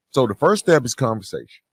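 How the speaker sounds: ordinary talk, nothing marked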